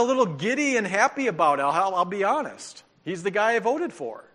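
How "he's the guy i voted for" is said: ordinary talk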